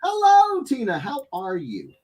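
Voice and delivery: high, bright hello; high friendly welcome to Tina; high greeting. Real, not acted. Greeting viewer.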